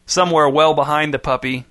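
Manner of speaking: usual speaking voice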